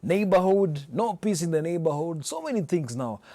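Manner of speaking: ordinary talk, nothing marked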